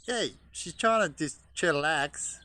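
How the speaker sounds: loud, high, slow scold; drawn-out loud reprimand; scolding call. Real, not acted. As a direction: tell the bird off from a distance. Scolding a bird.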